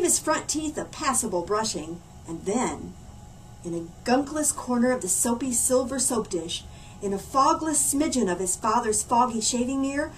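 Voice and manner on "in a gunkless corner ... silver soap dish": ordinary delivery, nothing marked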